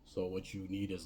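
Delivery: ordinary talk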